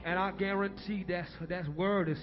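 soft altar call. Acted. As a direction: draw them in quietly over the music. Soft altar call after preaching.

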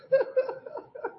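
sudden high-pitched laugh, quickly over; high burst of laughter. Real, not acted. To the congregation, laughing at his own cursing, high laugh. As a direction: let a bright laugh burst out.

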